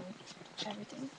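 ordinary talk, nothing marked

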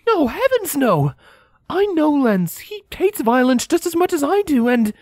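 high, shocked protest; shocked denial. Acted. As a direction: insist in shocked disbelief. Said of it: "Scarlet leaned away from the question, appalled".

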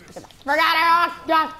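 high, loud outburst; alarmed yell. Real, not acted. Gamer driving enemies out of here, alarmed yelling. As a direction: holler them away.

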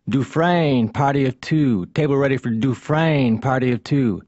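ordinary delivery

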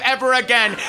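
ordinary delivery

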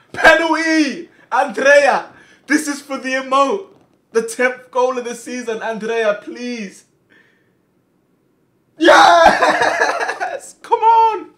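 high, loud, tense cheering; excited shouting. Real, not acted. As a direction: scream for the penalty to go in. Penalty taken and scored.

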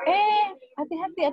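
high, slow cautioning call; high drawn-out be careful; spontaneous warning. Retold or imitated. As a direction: sing out a careful to the falling child. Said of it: "kita spontan mengatakan".

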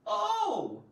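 high, loud surprised oh. Shown as a demonstration. Teacher showing a surprised interjection. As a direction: pop out a startled oh. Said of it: "the interjection".

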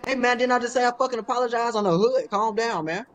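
hammed-up heated protest; mock angry. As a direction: push back hard at the friend. Heated back-and-forth argument.

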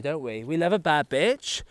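ordinary talk

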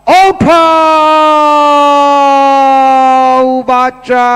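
long, loud, stretched-out call; drawn-out call. Real, not acted. Calling child to draw ticket.

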